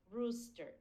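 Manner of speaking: normal talking voice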